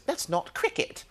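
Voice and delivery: a light posh English accent. Shown as a demonstration. Guest tries a British phrase on the hosts, English accent. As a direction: say it like an English gent. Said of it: "I believe the phrase is".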